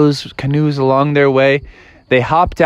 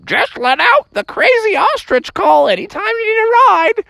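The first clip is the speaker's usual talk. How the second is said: very high squawky bird voice; ostrich farewell. Acted. Ostriches offer future rides, high squawk. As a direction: screech a friendly offer like a bird. Ostriches call back goodbye.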